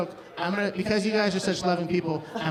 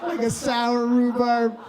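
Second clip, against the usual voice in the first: slow punchline; 3 semitones higher, much slower than usual. Acted. Punchline drawn out.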